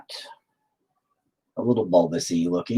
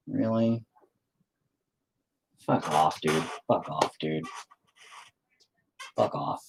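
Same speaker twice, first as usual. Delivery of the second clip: muttered fuck off; 6 semitones lower, 6 dB quieter, slower than usual. Real, not acted. Reacting to something annoying.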